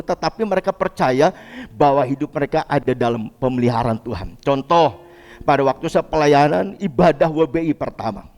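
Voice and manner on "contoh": ordinary delivery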